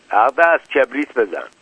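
ordinary delivery

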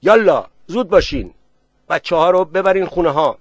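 loud, high, urgent order; commanding shout, pitched high; sharp shouted command. A narrator's reading. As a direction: bellow at them to hurry. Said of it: "کت خدا هم نهیب زد".

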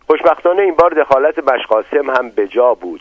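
ordinary delivery, nothing marked

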